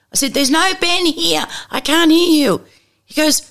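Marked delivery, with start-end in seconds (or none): 0.3-2.6 shouting down the phone. Retold or imitated. Shouting down a static phone line.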